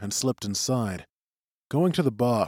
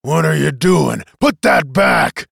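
loud, angry captain's rebuke; scowling anger; 4 semitones higher, 8 dB louder than usual. A narrator's reading. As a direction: snap loudly at a crewman caught snooping. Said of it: "jovial smile turned instantly to a scowl".

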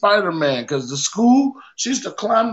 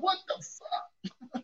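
whispered shock; 11 semitones higher, 16 dB quieter, much slower than usual. Retold or imitated. Shocked at near miss.

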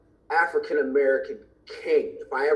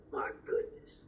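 soft, hushed little prayer; whispered prayer; 13 dB quieter, slower than usual. Retold or imitated. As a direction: breathe it out like a private thanks to God. Praying in front of the dumpster.

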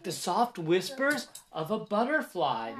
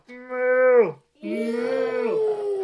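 cow moo; 5 semitones higher, 6 dB louder than usual. Acted. Reading animal sounds to kids.

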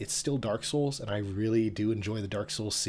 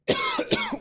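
harsh hacking cough; coughing up water; 3 dB louder than usual. Real, not acted. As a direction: hack hard, throat raw. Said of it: "this is the Dark Souls of coughing".

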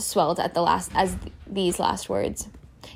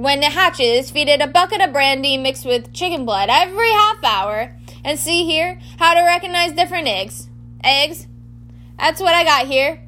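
higher, louder, eager character voice; proud amateur expert, pitched up; groundskeeper Hagrid, enthusiastic; 5 semitones higher, 4 dB louder than usual. Acted. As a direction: show off the book excitedly.

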